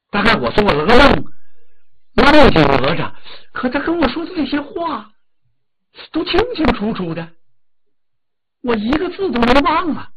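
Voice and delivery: mildly acted wonder, pitched high as she recalls a dream; lightly voiced wondering mother. Mother puzzles over her vivid dream.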